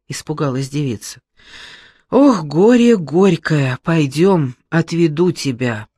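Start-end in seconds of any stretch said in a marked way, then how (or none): 2.1-5.9 lightly voiced old man sighing. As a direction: sigh it like a kind grandad. Old man sighs at girl.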